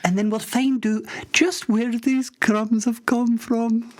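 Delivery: high-pitched comic Scottish radio host. Acted. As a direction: play a squeaky wee Scottish DJ.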